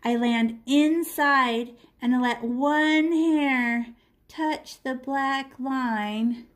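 slow, careful instruction; drawn-out teaching voice; slow demo. Shown as a demonstration. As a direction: spell it out slowly for the student. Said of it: "this is how we outline all of our windows".

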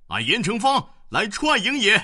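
very high, loud bellowed challenge; loud battle cry. A narrator's reading. General announcing himself at enemy camp, bellowing. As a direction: roar your name at the gate. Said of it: "高声大叫".

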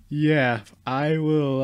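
usual speaking voice

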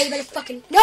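normal talking voice

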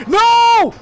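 high, loud frustrated shout. Real, not acted. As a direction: yell no at a failure. Failing in the game.

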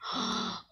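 sharp breathy gasp of awe; soft gasp. Real, not acted. Seeing the fancy house, gasping. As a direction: gasp at the sight.

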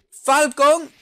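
high loud anime move call; attack call. Acted. Gamer calling out the fighter's move, hammed up. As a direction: bark the move name out.

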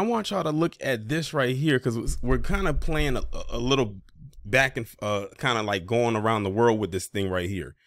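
usual speaking voice

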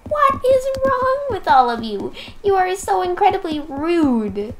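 high exasperated squeal; mock outrage. To the rude crowd, high mock outrage. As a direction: scold them all in a high pitch.

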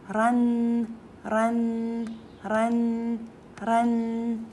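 "رن" said loud as a clear "ran"; sound ran. Shown as a demonstration. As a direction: drill "رن" for the class to echo. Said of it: "ويا تنوين الفتح". (نطق "رن" بصوت عالٍ)

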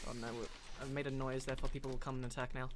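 low, soft and quick; hushed worry. Real, not acted. Player worried about being heard, hushed worry. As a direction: keep it down, talking low and fast. Worried noise will draw enemies.

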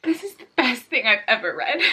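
tearful. Real, not acted. Emotional after finishing the book.

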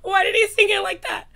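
high, loud laughing squeal; overwhelmed excitement. Real, not acted. Fan floored by how he sang it, overwhelmed excitement. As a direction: squeal it through laughter, almost crying.

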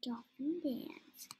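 whispered slowly. Real, not acted. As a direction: breathe it out slowly. Whispering game moves.

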